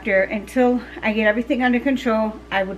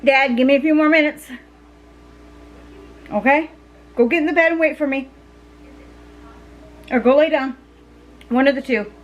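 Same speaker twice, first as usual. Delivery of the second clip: calling out; 3 semitones higher, 3 dB louder, faster than usual. Real, not acted. Calling to Dad across house.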